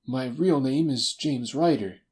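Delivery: high, slow admission; lightly voiced nervous man, slowed down; reluctant little man. Ryder confesses his name.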